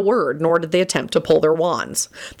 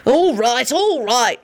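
higher-pitched irritated concession; snapping; 7 semitones higher, slower than usual. A narrator's reading. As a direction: snap it to cut them off. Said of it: "Lupin snapped".

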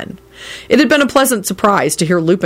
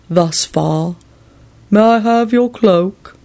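formal servant voice, drawn out at the end; grave servant; 3 semitones higher, slower than usual. A narrator's reading. Servant asking to take the guest's cloak, gravely. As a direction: offer service slowly and gravely. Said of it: "Barnabas responded gravely".

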